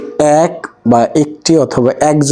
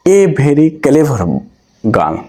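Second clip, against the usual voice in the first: reads "A very clever" very slowly; slow example reading; much slower than usual. Shown as a demonstration. Teacher reading an example phrase slowly to students. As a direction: draw each word out for learners. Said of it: "এখানে দেখো".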